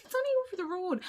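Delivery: lightly mocking the casual Irish phrase; mock local saying. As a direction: toss it off like it's nothing. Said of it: "We, we say that, don't we?".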